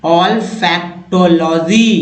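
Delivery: olfactology stretched out, each syllable separate; teacher modelling olfactology slowly. Shown as a demonstration. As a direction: break olfactology into slow syllables. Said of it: "उच्चारण करना सीख रहे हैं".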